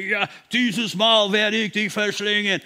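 lightly voiced roaring devil; mock devil roar. Preacher mimics the devil threatening the believer, mild mock roar. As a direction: imitate a big-mouthed devil threatening to devour. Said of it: "er schreit und er brüllt und er sagt".

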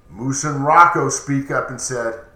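ordinary delivery, nothing marked

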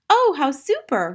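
put-on high, loud, drawn-out child's delight; delighted cry. A narrator's reading. Matilda thrilled by Miss Honey's news, crying out. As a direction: exclaim like a thrilled little girl. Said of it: "Matilda cried".

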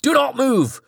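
high, hammed-up screamed command; screamed order. Petrified bodyguard screams at the possessed Charlie, high screaming order. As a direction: scream at them to freeze. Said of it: "he screamed".

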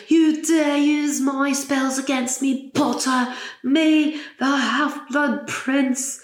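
put-on sneering British Snape accent, dragging every word; Snape impression. Fan doing a British Snape accent over Carlos's radio rant. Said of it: "Sorry about the accent".

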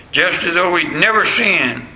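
usual speaking voice

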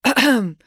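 short, nervous throat-clearing. A narrator's reading. Dad begins his speech, clearing his throat. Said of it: "clearing his throat".

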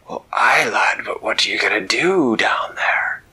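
loud salty pirate captain voice; an old sea dog amazed. Acted. Captain staring down at the lights, loud pirate voice.

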